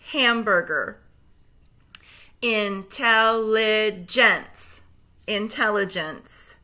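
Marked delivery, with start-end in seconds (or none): 2.4-4.8 slow, split into syllables; segmented syllables. Shown as a demonstration. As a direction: break Intelligence into slow pieces. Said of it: "say the syllables in segments".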